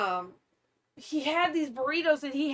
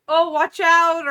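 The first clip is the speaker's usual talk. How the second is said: mock warning, louder and higher, drawn out; called-out warning; 6 semitones higher, 10 dB louder, slower than usual. Wife voicing what her husband should have said, called-out warning. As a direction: call a slow, loud mock warning. Acting the warning he didn't give.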